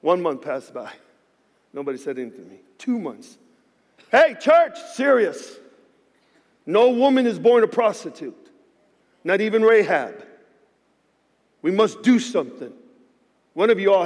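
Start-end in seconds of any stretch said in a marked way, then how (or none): 1.7-2.6 low and hushed, letting it sink in; quiet. Retold or imitated. Pastor telling the church nobody answered, hushed and low. Quietly recounting months of silence. 4.1-10.2 higher and louder, calling the church out; urgent appeal. Acted. Pastor calling on his church to act, loud and urgent.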